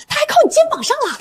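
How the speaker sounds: high, swooping scandalised tattle; gossipy excitement. Acted. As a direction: pitch soars with juicy gossip. Colleague relays juicy office gossip. (兴奋八卦)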